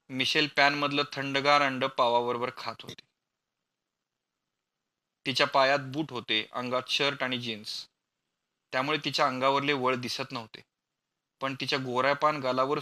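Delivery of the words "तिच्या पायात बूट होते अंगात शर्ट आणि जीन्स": usual speaking voice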